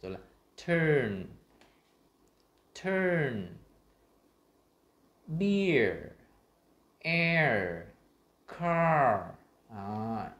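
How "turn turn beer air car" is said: "turn", "beer", "air", "car" loud and long, each falling from high pitch; emphatic stretched words swooping downward; throat resonance drill. Shown as a demonstration. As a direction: project each word, "turn, beer, air, car", with a held vowel and strong r. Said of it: "luyện những cái âm phát âm trong cổ họng".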